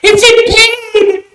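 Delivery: high, drawn-out cry of complaint; pained throat complaint. Real, not acted. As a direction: wail it slowly up high, falling at the end. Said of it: "How do you think my throat feels".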